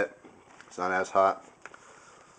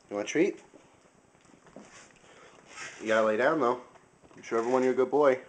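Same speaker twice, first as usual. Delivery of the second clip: talking to a dog; 3 semitones higher than usual. Real, not acted. Coaxing the dog for a treat.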